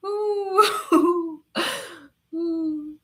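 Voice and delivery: strained laughing ooh. Real, not acted. Choking on laughter at the absurd question, ooh. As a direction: laugh through a catch in the throat.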